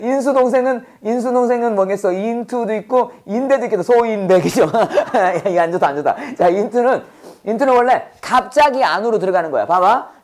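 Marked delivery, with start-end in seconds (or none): 4.7-5.4 quick chuckle at a pun; short amused laugh. Real, not acted. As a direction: let out a small teasing laugh. Laughs at the name teasing. (짧게 터진 장난스러운 웃음)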